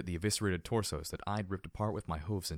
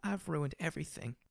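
sobbing; 8 semitones higher, 4 dB quieter than usual. A narrator's reading. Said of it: "she sobbed into her hooves".

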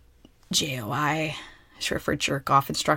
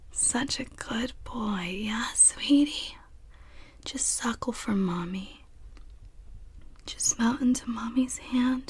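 put-on soothing a child; 5 semitones higher than usual. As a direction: hush and comfort, very gently.

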